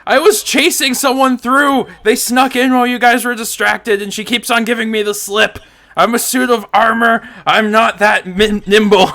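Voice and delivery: high, loud excuse called downstairs; indignant in-character shout. Acted. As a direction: shout your cover story down to the guards.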